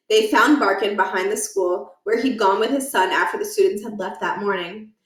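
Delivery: plain reading voice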